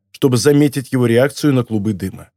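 plain reading voice

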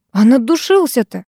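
mildly spiteful grumble; a touch of petty fault-finding; grumbling. A narrator's reading. Girl griping at his perfume, grumbling. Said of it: "из вредности я проворчала".

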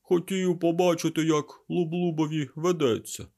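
high, loud, odd servant creature; strange creature voice. A narrator's reading. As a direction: pitch it high and uncanny. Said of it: "дивно не схожим на його звичне верескливе кавкання".

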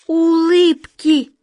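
high, loud, drawn-out exclamation of discovery; amazed gasp. Acted. Wizard discovering what the chest hides, astonished. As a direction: cry out the revelation slowly. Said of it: "ахнул волшебник Алёша".

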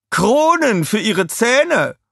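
high, slow queenly punchline; old queen. A narrator's reading. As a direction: land the punchline grandly. (hohe, langsame Pointe der Königin)

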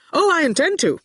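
light, high and breezy; cheerfully. A narrator's reading. Archer cheerfully answers Quentin about taking over the world, bright and breezy. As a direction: toss it off cheerfully, pleased with himself. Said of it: "Archer said cheerfully".

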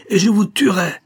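threat building to a loud, high peak; piercing cry. A narrator's reading. Stranger vows to kill the major, building to a piercing cry. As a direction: burst upward on the final vow to kill. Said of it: "On eût dit des cris perçants".

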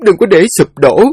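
high, pleading daughter comforting her father; a daughter begging her father to stay strong; tearful daughter. Acted. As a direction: hold back tears and urge him on.

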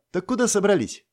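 put-on high teasing girl's voice; playful know-it-all girl. A narrator's reading. Lana teasing. (дразнящий девичий голос)

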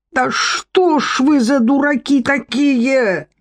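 high, drawn-out wail; hammed-up high-pitched complaint; exasperated exclamation. A narrator's reading. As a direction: cry it out slowly in a high character voice. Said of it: "воскликнула та голова".

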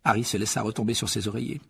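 usual speaking voice

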